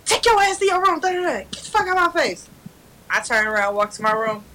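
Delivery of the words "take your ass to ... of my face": high but contained mock yell; imitated screaming mom. Mom sending child to room, mock screaming. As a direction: order the child off in a pitched-up voice. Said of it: "she get down screaming".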